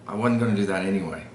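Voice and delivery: "wasn't" said quickly as "wudn't"; fast casual line, "wasn't" with a D for the Z; Southern-style "wudn't", rattled off. Shown as a demonstration. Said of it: "I also have a tendency to say".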